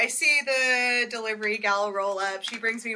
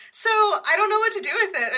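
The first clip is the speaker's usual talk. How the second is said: put-on much higher, louder girlish voice; mock delivery gal; 11 semitones higher, 6 dB louder than usual. Delivery gal handing over the tortoise, high-voiced. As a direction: play the clueless delivery gal.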